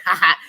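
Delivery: loud mock "ha, ha"; imitated laugh. Vlogger repeats the wife's laugh for viewers, imitated laugh. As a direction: punch out an imitation of her laugh. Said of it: "She laughed just like that".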